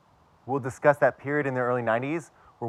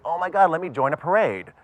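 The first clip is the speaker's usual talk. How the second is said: higher, louder fake enthusiasm; mock gushing; 6 semitones higher, 4 dB louder than usual. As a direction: gush like an overeager ally, tongue in cheek. Mocking expected instant acceptance.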